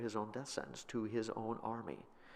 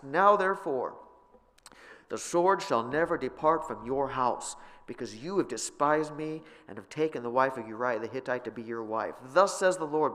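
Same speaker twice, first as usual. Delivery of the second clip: higher, louder prophetic proclamation; forceful scripture reading; 5 semitones higher, 8 dB louder than usual. Acted. Preacher reading Nathan's rebuke to David, loud proclamation. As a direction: thunder the prophet's words.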